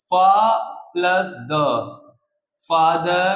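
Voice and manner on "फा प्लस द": "फा प्लस द" as two separate sound pieces; split in two. Shown as a demonstration. Teacher showing where फादर divides, two separate syllables. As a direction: break the word apart at "plus", each piece held. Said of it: "sound दो टुकड़ों में आए".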